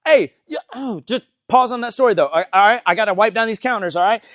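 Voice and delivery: loud, high, pointed interruption; mock-polite but pushy hostess; passive-aggressive. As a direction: cut in with hammed-up impatience. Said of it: "just kinda passive aggressive".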